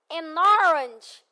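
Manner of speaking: "an orange" said slowly, linking the n; slow model pronunciation. Shown as a demonstration. Teacher models the phrase slowly for repetition. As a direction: stretch out "an orange" for students. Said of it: "o que que eu falo?".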